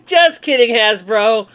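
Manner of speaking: high, loud laughing aside. Real, not acted. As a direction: laugh it off at Hasbro. Joking apology to brand.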